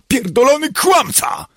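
high, furious scream; loud, shrieking accusation; yelled. A narrator's reading. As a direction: scream the insult in a rage. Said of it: "wrzasnęła".

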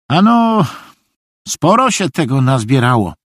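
high, naive reply; naive simpleton. A narrator's reading. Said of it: "odpowiedział naiwnie Szwejk".

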